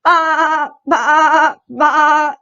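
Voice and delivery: playful farm-animal imitation; sheep bleat. A narrator's reading. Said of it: "said the fat red hen".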